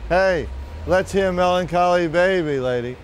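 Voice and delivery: lightly voiced drunk calling out; drunk heckler. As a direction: pester the singer like a tipsy heckler. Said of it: "He yells again".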